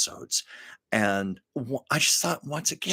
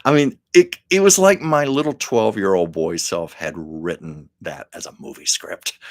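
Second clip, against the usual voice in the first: ordinary talk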